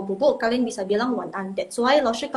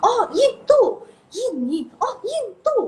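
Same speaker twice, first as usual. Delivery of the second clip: flustered tourist; 11 semitones higher, 3 dB louder than usual. Retold or imitated. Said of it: "terus bilangnya ini".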